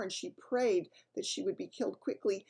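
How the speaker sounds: plain reading voice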